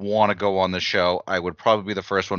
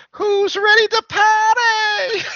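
high, slow, hammed-up party shout; Coach party voice; 7 dB louder, much slower than usual. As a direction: belt it like a rowdy party animal. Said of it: "I'm gonna do a really bad, rendition of it".

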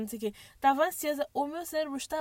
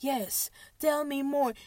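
slow, keen yes tell me more; eager nerd listening; much slower than usual. Retold or imitated. To the soldier showing weapons, slow eager interest. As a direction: play the fascinated student, nodding along. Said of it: "estou a abanar a cabeça".

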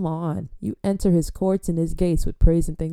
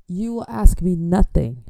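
"You will ask me nothing" slow and loud; emphatic repetition; 5 dB louder than usual. Shown as a demonstration. Teacher repeating the verse, slow emphatic insistence. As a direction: hit "ask me nothing" firmly and deliberately.

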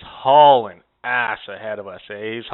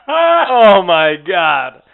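loud, high exclamation of disbelief; big high outburst falling away; retold shock; 7 semitones higher, 11 dB louder, much slower than usual. Retold shock at the man's slogan.

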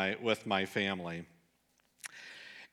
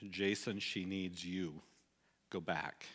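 slow, low and very soft urging; little inner voice; 5 semitones lower, 11 dB quieter than usual. Retold or imitated. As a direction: speak as a quiet conscience, unhurried. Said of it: "that little voice kept telling me".

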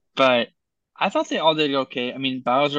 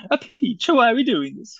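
high-pitched cartoon plumber voice; sing-song, hammed-up Italian character; Mario; 10 semitones higher, 3 dB louder than usual. Mocking a voice nobody wants all movie, high cartoon impression. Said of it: "that's not a bad impression right there".

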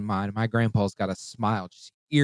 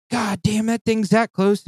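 amazed grandpa; 11 semitones higher than usual. Retold or imitated. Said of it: "He's like".

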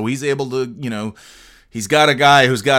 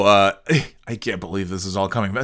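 ordinary delivery

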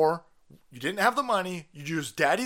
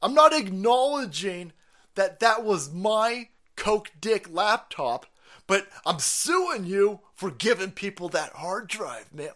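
usual speaking voice

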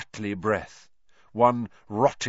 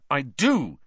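louder, higher fierce retort; fierce insistence; 5 semitones higher, 5 dB louder than usual. A narrator's reading. Boy refusing to give up the lesson, fierce insistence. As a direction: insist firmly, with force. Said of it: "said Harry fiercely".

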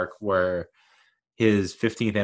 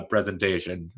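ordinary talk

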